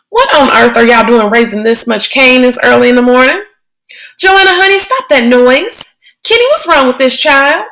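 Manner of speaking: put-on an old woman scolding the noisy kids; startled grandma, calling out; Grandma Sands.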